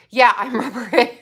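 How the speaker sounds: ordinary talk, nothing marked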